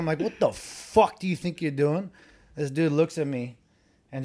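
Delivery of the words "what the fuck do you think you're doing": louder, higher, slowed-down confrontation; retold angry challenge. Storyteller replaying his challenge to a car thief, angry. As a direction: challenge him, leaning slowly on the words.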